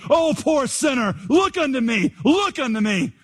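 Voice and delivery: high, chanting revival preacher; half-sung pulpit pleading; fervent preacher. Acted. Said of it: "followed up his text in this way".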